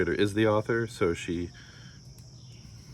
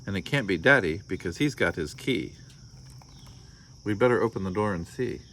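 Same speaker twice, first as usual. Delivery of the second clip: storybook read-aloud with voiced characters; story narration; 4 semitones higher than usual. A narrator's reading. Parent reading a picture book to listeners, lively storytelling. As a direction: read it like bedtime story time.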